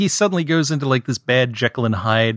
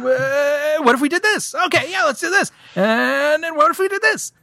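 very high squeaky silly voice; mock excited brainstorming. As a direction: pitch it way up, spoofing eager writers. Mocks writers escalating plot ideas.

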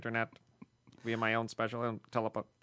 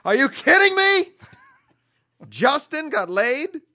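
shocked shriek; 14 semitones higher, 14 dB louder than usual. Acted. Reading listener email in voice.

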